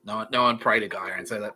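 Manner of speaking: usual speaking voice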